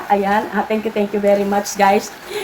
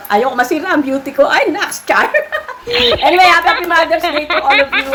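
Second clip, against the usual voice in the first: giggly, higher and louder; laughing; 7 semitones higher, 3 dB louder than usual. Real, not acted. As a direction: break into laughter and keep the lilt. Laughing after joking about her beauty.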